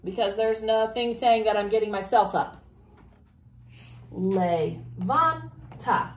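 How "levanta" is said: Levanta broken slowly into Le, van, ta; teacher sounding out Levanta in pieces; syllable by syllable. Shown as a demonstration. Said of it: "Where's the second to last syllable?".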